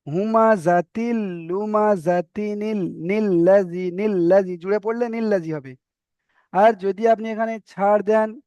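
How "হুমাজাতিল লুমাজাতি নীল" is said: হুমাজাতিল লুমাজাতি নীল read slowly with the নুন sounded into নীল; joined reading demo. Shown as a demonstration. Teacher showing students how to join the words, slowly. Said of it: "ভালো করে শুনে নেন".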